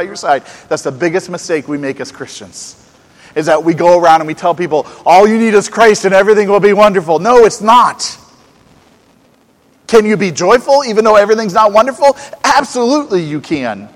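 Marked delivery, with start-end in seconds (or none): 3.3-13.2 louder, higher, building sermon cry; full-force preaching. Acted. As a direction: preach it out to the whole room.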